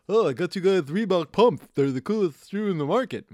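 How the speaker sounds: high, loud put-on dad voice; imitating dad. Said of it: "the one time my dad bought us Reebok pumps".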